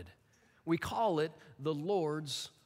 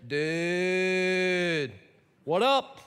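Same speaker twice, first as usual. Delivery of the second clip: drawn-out Dude then a louder what up; laid-back California friend; 5 dB louder, much slower than usual. Retold or imitated. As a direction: greet like a chill surfer buddy. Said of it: "somebody says".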